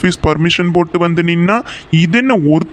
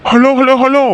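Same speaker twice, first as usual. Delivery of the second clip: high, louder hellos to cut her off; placating hellos; 7 semitones higher, 3 dB louder than usual. Acted. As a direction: raise the voice and wave her down with hellos. He tries to calm her.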